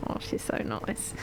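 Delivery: soft whispered aside. Real, not acted. Aside after guest leaves.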